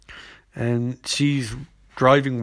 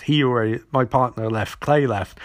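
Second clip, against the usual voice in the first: ordinary delivery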